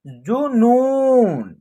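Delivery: जुनून with a brief first u and a long held second ū; short then long vowel. Shown as a demonstration. Teacher blending जुनून for learners, short then long. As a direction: sound out जुनून, stretching only the second vowel. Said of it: "इसका उच्चारण एक साथ करेंगे".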